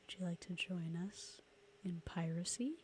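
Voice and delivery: eerie whispered lure; Lost Boys vampire whisper. Retold or imitated. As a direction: hiss it like a vampire recruiting. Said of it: "that's The Lost Boys".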